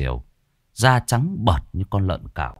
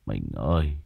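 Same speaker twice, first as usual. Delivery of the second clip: low, soft tearful call; tearful plea; 9 semitones lower, 5 dB quieter than usual. Acted. Husband kneeling at his wife's feet, low tearful voice. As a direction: begin the sobbing quietly and low. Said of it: "Lão òa khóc như đứa trẻ".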